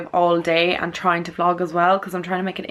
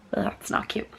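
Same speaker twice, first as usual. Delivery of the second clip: whispering; 9 dB quieter than usual. Real, not acted. Whispered aside about lip.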